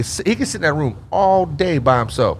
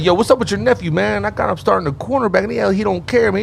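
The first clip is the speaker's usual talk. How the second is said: retold coach; 8 semitones higher than usual. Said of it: "He's like".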